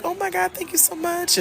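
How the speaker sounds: mock thrilled thank-you from the neighbours; delighted thanks. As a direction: gush like someone thrilled by a gift. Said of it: "They were like".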